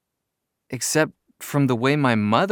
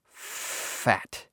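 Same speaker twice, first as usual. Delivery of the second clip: whispered; 3 semitones lower, 7 dB quieter than usual. Shown as a demonstration. Narrator whispering the forbidden word Fat. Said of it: "Here goes".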